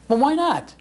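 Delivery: high, loud, indignant "why not?"; surprised protest. Retold or imitated. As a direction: toss it back, startled and offended. Retold protest over losing names.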